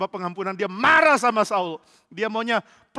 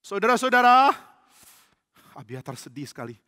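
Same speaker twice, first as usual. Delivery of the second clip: plain reading voice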